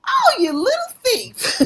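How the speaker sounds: high, slow, laughing tease; laughing accusation. Retold or imitated. Retelling to her co-hosts, laughing high-pitched mock accusation. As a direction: laugh it out like catching a playful thief. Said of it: "I said".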